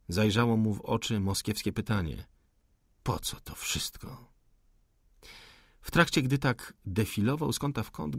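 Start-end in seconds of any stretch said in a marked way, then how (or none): 3.0-4.3 near-whispered, voiceless question; hushed inner question. A narrator's reading. Officer asks himself after all the fighting, hushed. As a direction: ask it under the breath.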